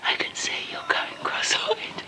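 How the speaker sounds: soft unvoiced whisper. Real, not acted. Whispering to the baby.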